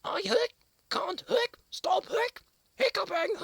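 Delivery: high, loud squeaky complaint; high squeaky plea. Acted. Squirrel Monkey wailing to Leo about hiccups, high squeak. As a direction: wail it up high like a small monkey.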